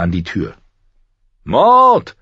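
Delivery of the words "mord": loud, high call through the door. A narrator's reading. Prisoner yells for the jailer through the door. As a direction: yell the jailer's name to be heard. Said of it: "rief er".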